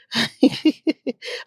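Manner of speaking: high little giggle; short laugh. Real, not acted. Vlogger catching herself off course, short giggle. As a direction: laugh lightly at yourself.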